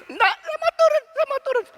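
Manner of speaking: very high, loud pleading wail; imitated scared child. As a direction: beg like a frightened toddler. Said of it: "dia mulai takut".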